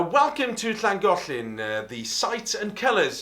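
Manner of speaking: bright broadcast piece to camera; presenter voice. Shown as a demonstration. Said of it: "doing a piece to camera".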